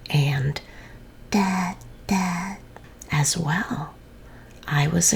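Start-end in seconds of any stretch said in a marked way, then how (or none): 1.3-2.6 put-on baby voice. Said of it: "our funny baby was saying".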